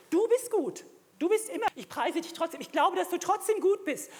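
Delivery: high, loud proclamation of faith; fervent declaration. Shown as a demonstration. Preacher showing believers how to praise God, fervent declaration. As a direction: cry it out with full conviction.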